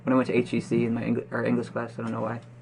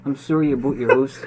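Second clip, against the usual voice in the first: mock Canadian; 2 semitones higher, 6 dB louder than usual.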